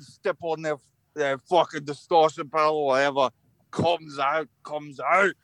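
broad, hammed-up Scottish accent; exaggerated Scottish accent, a bit wobbly. Shown as a demonstration. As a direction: put on a rowdy amateur Scottish brogue. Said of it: "respond in my best Scottish accent".

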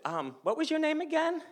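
very high, sheepish asking; clueless question. Retold or imitated. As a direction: pitch it way up, innocently clueless. Clueless question, acted for laughs.

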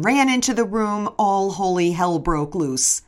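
plain reading voice

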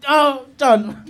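ordinary talk

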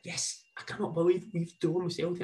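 high, thrilled retold cheer that stays soft; mock-excited boy's voice; excited kid cheer. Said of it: "He's shouting at the ball".

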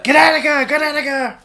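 high, loud shout of Gattaca; yelling Gattaca. Shown as a demonstration. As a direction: scream Gattaca. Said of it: "I yell Gattaca a lot".

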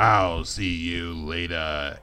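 low, slow bounty hunter voice; slow and low like Boba Fett. Retold or imitated. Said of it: "How was that?".

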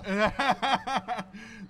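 loud, high laugh; big burst of laughter. Real, not acted. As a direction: crack up out loud. Reacts to the funny story.

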